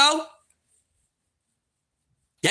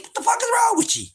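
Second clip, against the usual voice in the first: disbelief; 9 semitones higher, faster than usual. Retold or imitated. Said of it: "I said".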